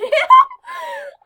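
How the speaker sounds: high loud laugh burst; shrieking laugh. Real, not acted. Laughing at emo Animal Jam.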